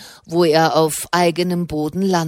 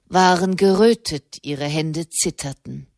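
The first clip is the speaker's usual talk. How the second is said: plain reading voice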